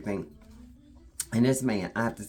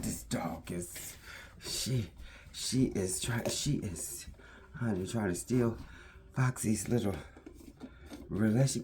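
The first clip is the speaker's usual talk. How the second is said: hushed aside; 8 dB quieter than usual. Real, not acted. Aside about the dog.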